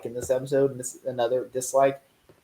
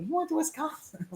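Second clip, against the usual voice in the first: soft, high-pitched astonished squeak; mock incredulity; 12 semitones higher, 11 dB quieter than usual. Recalling the funny Wisconsin line, softly squeaked disbelief. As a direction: repeat the baffled question small and high.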